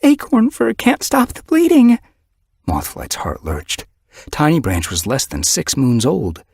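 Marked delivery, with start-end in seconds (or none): 0.0-2.0 high, loud, breathless panic; terrified she-cat reporting an injured kit; desperate report. Acted. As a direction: blurt the news in a frantic rush. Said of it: "Sparrowfur was trembling".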